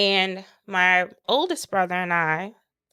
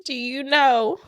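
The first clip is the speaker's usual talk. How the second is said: tearful; 6 semitones higher than usual. Real, not acted. Tearful retelling after crying.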